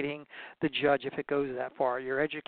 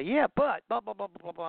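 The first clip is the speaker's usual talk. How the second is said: rambling know-it-all; 6 semitones higher than usual. Retold or imitated. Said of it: "I could also say".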